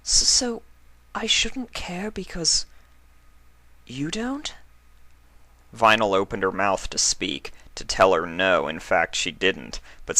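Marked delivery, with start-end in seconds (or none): 0.0-4.5 higher, slow, whispered question; hurt whisper, much higher; small tearful whisper. Acted. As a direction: ask it barely aloud, hurt. Octavia tearfully asks.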